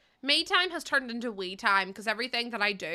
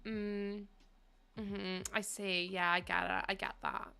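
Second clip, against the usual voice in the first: listening helpline guy; 8 dB quieter, slower than usual. Retold or imitated. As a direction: hum along like a detached listener. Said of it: "the guy was like".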